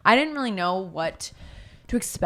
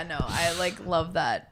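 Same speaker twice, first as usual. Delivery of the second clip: usual speaking voice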